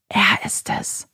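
slow, breathless whisper to her friend. A narrator's reading. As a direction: whisper it so the caller can't hear. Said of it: "flüstert Louise ihm zu".

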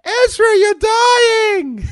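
screeching Ewok and Loth cat hybrid; annoying creature voice. Acted. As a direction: shout it very high and loud. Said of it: "they could talk like it".

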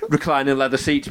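ordinary talk

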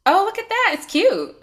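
high, delighted squeal; excited. Real, not acted. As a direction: gush over the sweatshirt. Admiring friend's sweatshirt.

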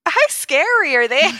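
loud, high, delighted question; half-laughing excitement; excited. Real, not acted. Excited reaction to scary packaging.

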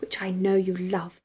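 breathy low seductive whisper. Pretend. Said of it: "in my best seductive voice".

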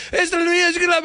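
high-pitched, garbled rapid-fire babble; mock speed talk. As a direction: imitate Newfies jabbering too fast to follow. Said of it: "like, speed talking".

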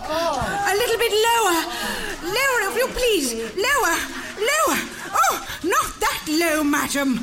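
high, loud comic moaning directions; massage client. Acted. Miss Tarpley instructs her masseuse, high comic pleasure. Acted spa massage in sketch.

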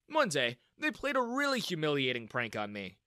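plain reading voice

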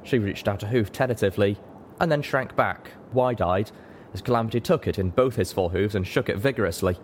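usual speaking voice

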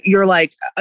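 usual speaking voice

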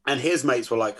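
usual speaking voice

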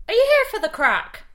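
high mock Irish lilt; Dublin cabbie. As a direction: ask it like a cheeky Dublin driver. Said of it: "Second response".

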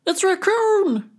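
high, slow squeaky little voice; squeaky crayfish. Acted. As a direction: squeak it out in fright. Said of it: "squeaked the crayfish".

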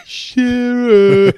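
high, loud "Shearer" called out; mock shout of a name. Drunk fan calling out to Alan Shearer, shouted. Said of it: "it's like".

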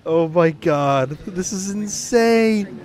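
loud, high, drawn-out amazement; amazed disbelief. Real, not acted. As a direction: stretch it out high, stunned and thrilled. Amazed reaction to the clip.

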